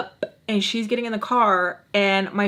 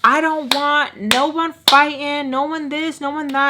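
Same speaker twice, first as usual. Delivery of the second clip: higher, drawn-out mock nagging; imitated mom's warning; 5 semitones higher than usual. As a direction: lay down the rules like a fretting mom, higher.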